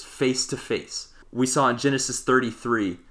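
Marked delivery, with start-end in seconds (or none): 0.0-1.1 "face to face" slow, each face leaned on; stressed key phrase. Shown as a demonstration. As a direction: drag out "face to face" to underline it. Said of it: "that's a key phrase".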